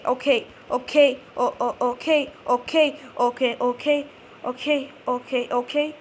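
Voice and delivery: ordinary talk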